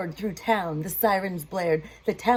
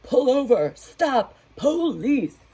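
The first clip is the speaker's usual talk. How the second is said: loud, higher police shout; sheriff hollering; 5 semitones higher, 6 dB louder than usual. Acted. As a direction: bark it like a sheriff at a car. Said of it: "sheriff hollered out".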